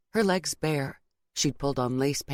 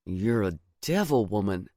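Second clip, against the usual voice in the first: low male lover's groan of words; 8 semitones lower than usual. A narrator's reading. Boyfriend to teasing girlfriend, low groaned complaint. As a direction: groan it out in a deep voice. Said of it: "He groaned".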